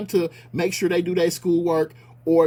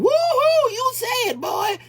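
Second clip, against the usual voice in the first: mock cheering crowd; much slower than usual. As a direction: whoop it up like a fawning audience. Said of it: "getting up clapping".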